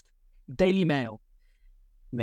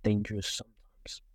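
whisper; 6 semitones lower, 5 dB quieter than usual. Acted. Ominous warning about dog.

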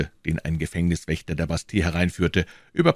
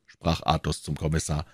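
usual speaking voice

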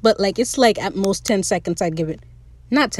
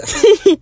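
high, loud burst of giggles; short helpless laugh; 10 semitones higher, 6 dB louder than usual. Real, not acted. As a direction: break into a sudden high giggle. Laughing before quoting Fang.

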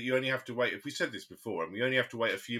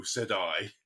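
normal talking voice

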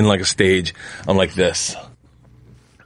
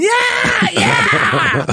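high shouted cheer; crowd cheer. Retold or imitated. Dream audience cheering the dancers, high shout. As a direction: whoop like a thrilled audience.

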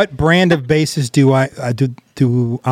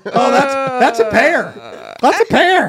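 much higher, slow teasing voice; high mock voice; 10 semitones higher, slower than usual. Real, not acted. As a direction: squeal it out, teasing. Mock announcing the pear.

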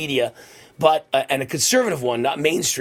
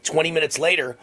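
ordinary talk

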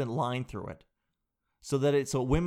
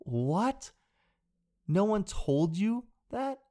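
slow, higher incredulous repeat; stunned question; disbelief; 6 semitones higher, much slower than usual. Real, not acted. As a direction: draw it out, unable to believe it. Reacting in disbelief.

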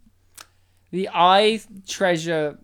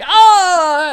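pained yell; 10 semitones higher, 11 dB louder than usual. Retold or imitated. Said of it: "and he's like".

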